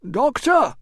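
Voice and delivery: high, loud exclamation; exclaiming. A narrator's reading. Administrator half rising to the doctor, high exclamation. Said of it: "He half rose to exclaim".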